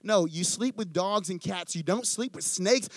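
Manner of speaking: usual speaking voice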